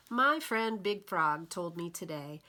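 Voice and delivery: ordinary delivery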